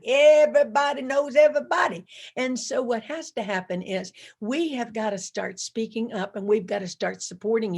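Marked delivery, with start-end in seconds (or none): 0.0-2.1 louder emphatic repeat. Shown as a demonstration. Speaker to the audience, driving the point louder. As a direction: punch it out louder to the crowd.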